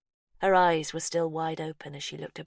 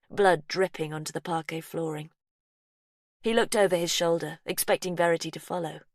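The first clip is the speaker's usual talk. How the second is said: ordinary delivery, nothing marked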